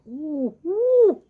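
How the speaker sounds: high, loud, drawn-out "ooh"; dazzled cooing at sudden bright light; swooping "ooh, ooh" as if stepping out of a cave; acted ooh. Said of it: "you're like".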